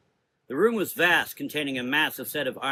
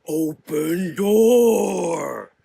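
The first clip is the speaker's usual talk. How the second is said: slow, louder furious roar; angry rumble; 5 semitones higher, 9 dB louder, much slower than usual. A narrator's reading. Fire creature enraged at the sisters, slow furious roar. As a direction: bellow the words out in rage. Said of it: "rumbled in heightened anger".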